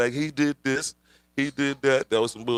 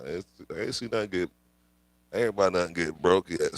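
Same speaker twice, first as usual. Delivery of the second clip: usual speaking voice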